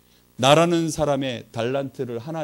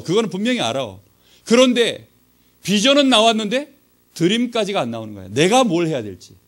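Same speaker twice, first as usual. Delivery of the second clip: normal talking voice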